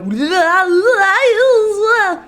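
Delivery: high, loud garbled blurt; loud nonsense mouth noise. Shown as a demonstration. Streamer showing what the mouth did, loud garbled noise. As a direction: let the mouth flail out wordless gibberish. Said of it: "my mouth is gonna go".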